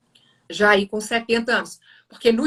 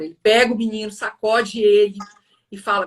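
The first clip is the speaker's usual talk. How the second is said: ordinary delivery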